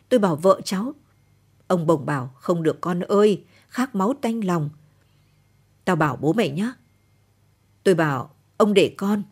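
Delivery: ordinary delivery, nothing marked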